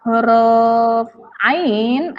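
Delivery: very slow "ain" pulled from the throat; teaching the ain sound slowly; drawn-out ain. Shown as a demonstration. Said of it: "Seharusnya huruf".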